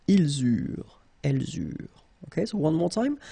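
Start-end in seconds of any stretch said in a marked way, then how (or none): 0.0-1.9 "ils eurent, elles eurent" linked with a z; liaison. Shown as a demonstration. Teacher demonstrating the link to learners, liaison. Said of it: "with the link, the liaison".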